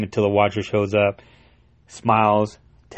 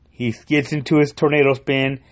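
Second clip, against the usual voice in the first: usual speaking voice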